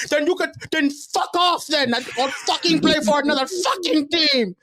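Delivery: high-pitched, loud screaming impression of a furious father, laughing through it; imitated raging dad. Coach imitates the dad screaming at him about benching his son, high furious yell. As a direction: shriek it like an enraged parent losing it at the coach. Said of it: "going nuts".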